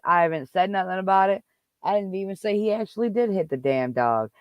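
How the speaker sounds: ordinary talk, nothing marked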